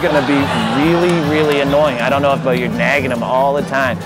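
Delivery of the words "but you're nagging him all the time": ordinary talk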